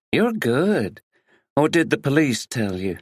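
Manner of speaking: teasing American actress; American accent. A narrator's reading. Actress Tina impressed by the detective, American accent. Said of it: "She spoke in an American accent".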